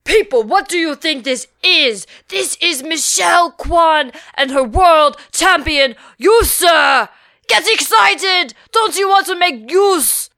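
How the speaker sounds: loud, high hype-man yelling; rally the crowd like a frantic emcee; yelling through a megaphone. Retold or imitated. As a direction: megaphone yell at the audience. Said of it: "he yelled from behind a megaphone".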